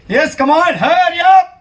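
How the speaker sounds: high, loud yell to be copied; loud shout. Shown as a demonstration. Magician shows Karanbhai how to yell, loud shout. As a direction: yell it at the top of your voice. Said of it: "રાડ પાડવાની ઊંચા અવાજે".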